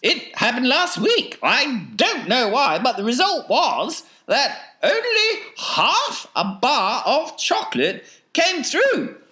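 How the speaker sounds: high theatrical falsetto; fussily explaining Wonka. A narrator's reading. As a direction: explain a mishap in a piping eccentric voice.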